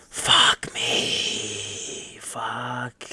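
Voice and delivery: breathy whispered fuck me; whispered curse. Real, not acted. Fed up with the plot, whispered curse. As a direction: exhale the curse under breath.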